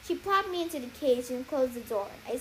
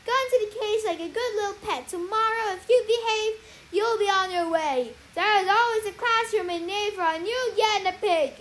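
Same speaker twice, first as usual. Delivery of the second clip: higher, louder stern threat; scolding sorceress; 6 semitones higher, 7 dB louder than usual. Acted. Cece scolding the guinea pig in her grip, stern threat. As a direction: scold a naughty pet with menace. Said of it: "she scolded".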